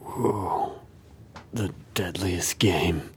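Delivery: slow, soft ominous whisper; spooky whisper. Acted. Listener reacts to the hunter story in a spooky whisper. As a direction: breathe it like a horror title.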